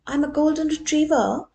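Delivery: loud, high sudden boast; loud, high blurt. Acted. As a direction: blurt it out without thinking. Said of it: "he blurted out".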